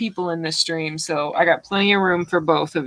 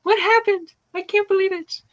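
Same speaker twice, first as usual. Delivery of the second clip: stunned, rising disbelief, pitched higher; hammed-up startled double take; mock shock; 11 semitones higher than usual. Host imagining campaign end, mock surprise. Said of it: "I'm gonna look up and go".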